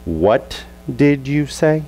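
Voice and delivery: mom's slow, deliberate "What did you say?"; slow, pause between every word. Retold or imitated. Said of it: "there's a comma between every word".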